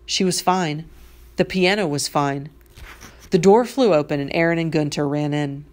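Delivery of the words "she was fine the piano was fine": ordinary delivery, nothing marked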